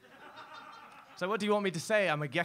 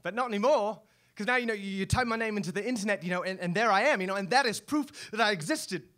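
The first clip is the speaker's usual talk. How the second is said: louder, higher rising conviction; impassioned; 4 semitones higher, 5 dB louder than usual. Acted. Gecko insisting online fame proves he existed, impassioned and louder. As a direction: build to a passionate declaration. Emotional climax of monologue.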